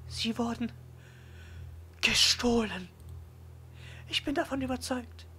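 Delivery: put-on high, friendly librarian girl. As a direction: play a bright young girl. Yuko voiced by narrator.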